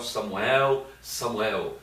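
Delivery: normal talking voice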